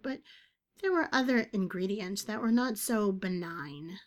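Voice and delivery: plain reading voice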